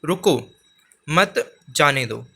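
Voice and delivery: "रुको", clear break, then slow "मत, जाने दो"; pause after रुको. Shown as a demonstration. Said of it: "दूसरे वाक्य को पढ़ेंगे".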